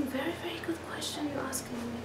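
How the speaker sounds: hushed, quick whisper; soft whisper. Retold or imitated. Hillel answers the rude question, whispered softly. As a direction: answer gently under the breath. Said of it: "in a soft tone".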